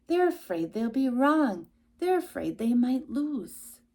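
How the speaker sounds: ordinary delivery, nothing marked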